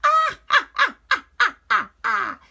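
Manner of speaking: high, loud evil witch cackle. Acted. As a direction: cackle in triumph. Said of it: "she crackled".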